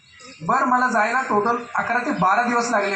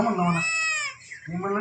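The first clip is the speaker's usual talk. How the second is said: choked up; 6 dB quieter, faster than usual. Real, not acted. Choked up retelling the hard moment.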